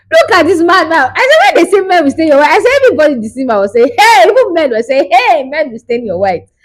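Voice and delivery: high, loud, indignant outburst; outraged exclamation. Retold or imitated. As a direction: cry out as if to the whole cinema. Re-enacting outraged reaction in cinema.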